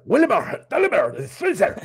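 put-on announcing of the name, pitch swooping up; exaggerated name. Shown as a demonstration. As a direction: say "Willenbar-, Dallenberg Streiser" big and theatrical.

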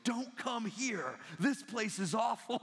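high, drawn-out confidential warning; imitating a desperate insider's tip-off; urgent mock warning. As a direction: lean in and stretch each word out. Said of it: "he leans in and he goes".

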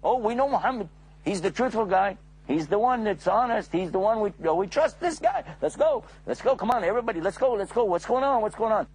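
eager voices gathering on the hill; a lightly voiced excited crowd; curious crowd. Townspeople answering the call.

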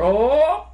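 loud, high, rising "oh" of mock alarm; hammed-up cry of dismay; mock panicked wail. Costumed man fleeing the police in a scramble, mock panic.

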